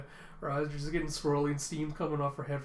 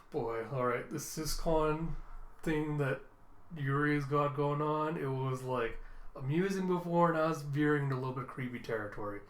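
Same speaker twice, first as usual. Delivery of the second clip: ordinary delivery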